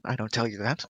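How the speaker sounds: ordinary talk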